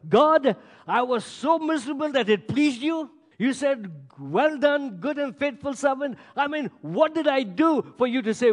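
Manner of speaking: high, loud baffled plea to God; retold incredulous protest. Acts out his baffled reply to God.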